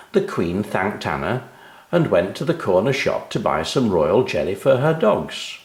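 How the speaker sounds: usual speaking voice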